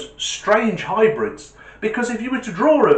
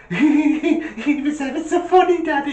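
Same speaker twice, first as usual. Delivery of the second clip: put-on high, giggly child; small boy tickled by what he saw; very high kid voice; little voice; 12 semitones higher, 3 dB louder than usual. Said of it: "the little voice goes".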